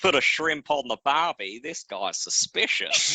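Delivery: hammed-up Aussie cliché voice; mock Australian accent. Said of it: "the only thing we know that is Australian".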